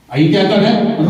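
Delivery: usual speaking voice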